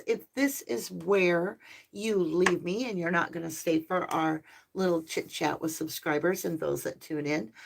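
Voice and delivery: usual speaking voice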